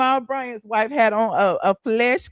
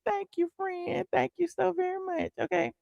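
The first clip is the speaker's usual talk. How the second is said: mock sweet thanks; 9 semitones higher, 9 dB quieter than usual. Real, not acted. Thanking a friend for compliment.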